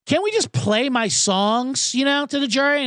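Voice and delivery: producer pleading to the judge about his songs. Retold or imitated. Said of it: "He goes".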